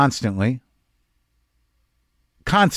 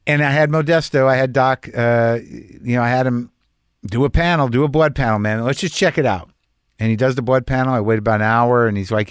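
usual speaking voice

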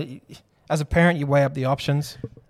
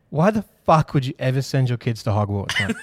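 ordinary talk

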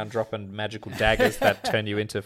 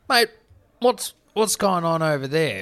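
higher-pitched casual call; in-character question pitched up to carry; calling over; 9 semitones higher, 5 dB louder than usual. Acted. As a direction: call across to someone nearby. Player calls over to the orc.

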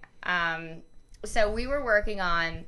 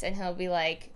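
ordinary delivery

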